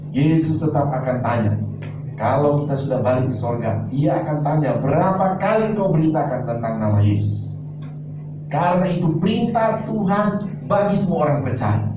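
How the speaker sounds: ordinary talk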